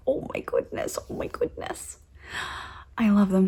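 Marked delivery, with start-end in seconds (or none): 0.1-2.0 breathless whispered oh my goodness; whispered gush. Real, not acted. Melting over the kitty pins, soft whisper. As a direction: whisper it, overwhelmed by cuteness. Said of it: "this voice just comes out".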